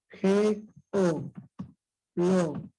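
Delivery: G, O, lo one at a time with pauses; split into syllables. Shown as a demonstration. Teacher writes out geología, syllable by syllable. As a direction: dictate each syllable separately. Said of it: "Cómo sería en el caso de la palabra geología".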